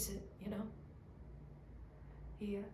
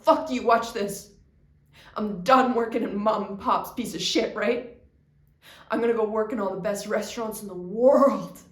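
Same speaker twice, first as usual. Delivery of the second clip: defiant shout; 16 dB louder than usual. Retold or imitated. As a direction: spit it out, fuming. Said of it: "And I just thought".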